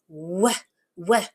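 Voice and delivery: "W" as a short wuh sound, said twice; isolated first sound. Shown as a demonstration. Teacher helps child hear first sound, "W" as short wuh. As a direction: sound out "W" as wuh, alone, with a pause. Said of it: "what that first sound is".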